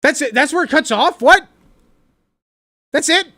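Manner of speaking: loud, high disbelief; stunned the clip cut off, loud and high; surprised. Real, not acted. Clip ends abruptly.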